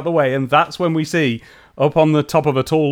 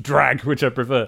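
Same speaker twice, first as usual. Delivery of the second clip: ordinary talk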